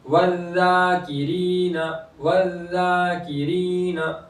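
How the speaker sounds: वल्लाकिरीना with ला and री both held long; stretched long vowels. Shown as a demonstration. Teacher shows both madd sounds, वल्लाकिरीना with two long vowels. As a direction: draw out आ and ई in वल्लाकिरीना. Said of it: "तो इसको भी खींचेंगे".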